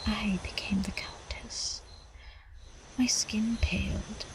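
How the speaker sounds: breathy, secretive whisper of the change; a soft whisper as she becomes the vampire; hushed whisper. Acted. Countess transforming, hushed telling.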